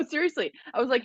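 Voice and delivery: usual speaking voice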